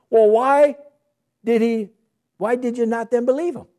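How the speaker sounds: high, loud indignant why; mock challenge. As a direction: throw the question back at them. Voices the imagined reply of Jesus.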